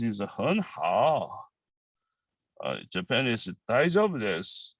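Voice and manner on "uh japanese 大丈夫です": normal talking voice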